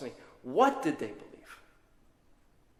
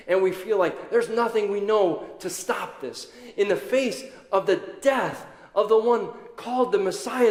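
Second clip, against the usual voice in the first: loud, higher, rising sermon cadence; preaching at full force; 5 semitones higher, 6 dB louder than usual. Acted. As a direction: preach it with mounting power. Preaching climax.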